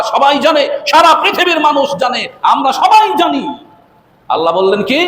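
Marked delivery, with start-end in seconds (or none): 0.0-3.6 high, emphatic preacher's voice; impassioned preaching. Real, not acted. Preacher telling the congregation the whole world knows, impassioned preaching. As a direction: raise the pitch and ring it out. Preacher rises to full force.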